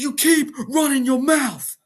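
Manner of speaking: high, riled-up wrestler imitation; mock angry challenge. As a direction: imitate a wrestler calling someone out.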